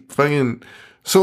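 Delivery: ordinary talk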